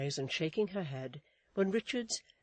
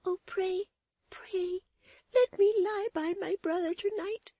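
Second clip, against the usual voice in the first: put-on tearful child; 5 dB louder than usual. A narrator's reading. Florence begs to lie by her brother, tearful child. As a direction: plead as a tearful child, pitched way up. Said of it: "in a voice broken by sobs and tears".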